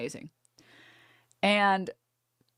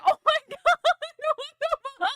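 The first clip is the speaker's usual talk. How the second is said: high squealing laugh through the words; laughing disbelief; 4 dB louder than usual. Retold or imitated. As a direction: shriek it out, laughing in shock. Outraged disbelief while laughing.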